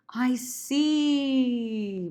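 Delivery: long, high, loud "see"; stretched out. Shown as a demonstration. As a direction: hold SEE out at length. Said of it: "I wanna stretch that out".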